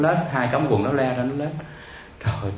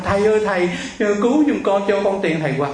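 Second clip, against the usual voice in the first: higher, louder begging call; imitated child crying out; 8 semitones higher, 4 dB louder than usual. Legless girl begs the passing monk for money, crying out. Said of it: "tự nhiên tôi nghe nó la lên".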